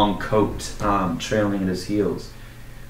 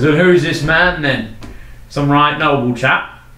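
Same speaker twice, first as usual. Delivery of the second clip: loud, higher, cheery local; hearty townsman; 5 semitones higher, 4 dB louder than usual. Acted. Townsman hailing the sad knight in the inn. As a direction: call out to him like a pub regular. Townsman asks about rival.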